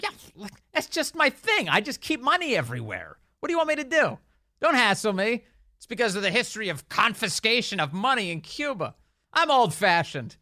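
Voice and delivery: high, whiny mock of a cornered senator; mocking Menendez. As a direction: sneer it out like a dodgy politician playing dumb. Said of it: "that's Menendez today saying".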